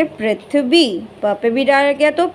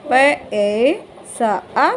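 the sounds of पैसा drawn out one at a time, slowly; spelled out slowly; much slower than usual. Shown as a demonstration. Teacher to child, spelling out पैसा very slowly. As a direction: break पैसा into slow separate sounds. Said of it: "पैसा".

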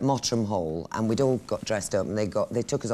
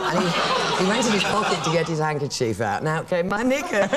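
giggling through the punchline; words bubbling with laughter; laughing while telling; 5 semitones higher, 4 dB louder than usual. Real, not acted. Comic climax of knicker story.

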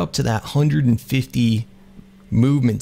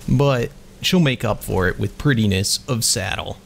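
ordinary talk

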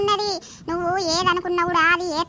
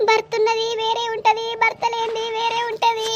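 acted tearful wail, higher pitch; 4 semitones higher than usual. Grieving widow laments to her neighbour, tearful. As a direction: break into sobs as the words end. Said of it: "ఆగాగు, నువ్వు ఏడవ కాగు".

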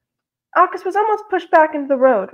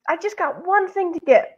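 higher, pleading voice; 6 semitones higher than usual. A narrator's reading. Latecomer begs the closing store's clerk, pleading. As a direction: plead it, pitched up. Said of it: "August pleaded".

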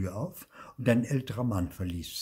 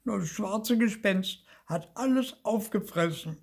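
high-pitched whiny little ghost; acted childish howl of complaint; wailing; 13 semitones higher, 4 dB louder than usual. A narrator's reading. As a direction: wail an accusation in a high voice. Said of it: "heulte Zipfel".

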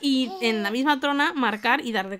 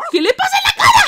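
high shocked shriek at the dog's face; 9 dB louder, faster than usual. Real, not acted. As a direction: cry out in disbelief, loud and high. (grito agudo de asombro)

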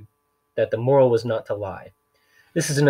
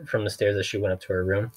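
ordinary delivery

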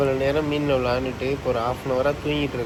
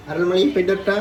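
raised, higher parting call; calling out goodbye; 6 semitones higher, 6 dB louder than usual. Real, not acted. Mother calling goodbye before going for the child, called out. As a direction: call out that you are off.

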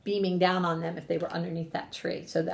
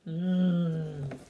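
plain reading voice

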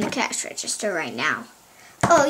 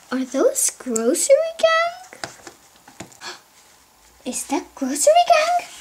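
high, astonished questioning; amazed discovery; 12 semitones higher than usual. Pretend. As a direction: ask it high, hardly believing it. Villain spots coveted toys.

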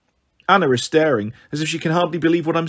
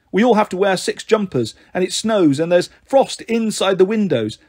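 ordinary delivery, nothing marked

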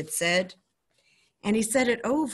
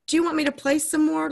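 put-on high, bright child's question; boy's voice; 9 semitones higher, 5 dB louder, faster than usual. Boy asking his grandfather, high and hopeful.

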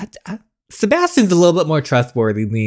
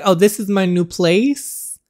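high, slow, mock-proud voice; showing off her new place; 8 semitones higher, 3 dB louder, slower than usual. Imitating the girl showing off her new home, in a mock-proud voice. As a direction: strut through it like a smug new homeowner. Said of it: "She's like".

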